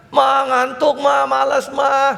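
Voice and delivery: high, loud, very slow whine; child begging mother to stay in bed, whining; whiny sleepy child. Retold or imitated. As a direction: drag it out like a kid who won't get up.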